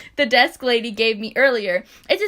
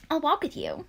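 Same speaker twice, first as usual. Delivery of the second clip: "I'll walk with you" higher and much softer, sugary; said sweetly; 4 semitones higher, 7 dB quieter than usual. Shown as a demonstration. Luke offering to walk Ella to class, said sweetly. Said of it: "he said sweetly".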